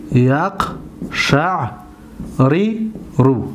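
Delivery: یقشعر رو very slowly as yaq, sha', ri, ru; original unmerged form. Shown as a demonstration. Teacher repeating the original form, یقشعر رو syllable by syllable. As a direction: leave a clear gap after each syllable of یقشعر رو. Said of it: "اصل این هست".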